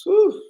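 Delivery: high, forceful exhaled whew; sharp whew to hold back tears. Real, not acted. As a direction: let out an emotional whew.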